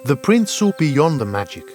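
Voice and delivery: ordinary delivery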